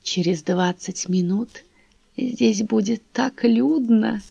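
put-on slow, half-whispered old man voice; a creaky old man murmuring close. A narrator's reading. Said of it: "схватил Лизу за локоть ... и сказал".

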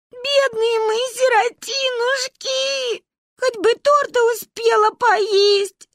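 put-on very high, slow childish keening; woeful lamenting. A narrator's reading. Two girls lament their orphanhood, slow high wailing. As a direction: wail in self-pity, stretching every word. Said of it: "сокрушались мы в унисон".

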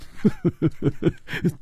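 soft, rippling chuckle; amused chuckle. A narrator's reading. Chu Liuxiang enjoying his friend's shock, soft chuckle. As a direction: chuckle before confirming the news. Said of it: "楚留香说".

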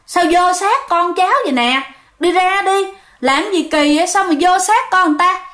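a hint of an exorcist chiding a ghost; scolding the spirit.

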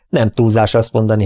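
ordinary delivery, nothing marked

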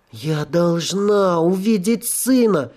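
slow, pleading ghost demand; insistent ghost. Acted. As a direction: insist like a desperate spirit. Ghost insists, repeated thrice.

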